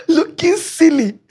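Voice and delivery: high giggle through the words; laughing. Real, not acted. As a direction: laugh at her own silliness. Laughs at own embarrassment.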